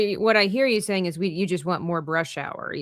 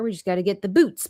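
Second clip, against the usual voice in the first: normal talking voice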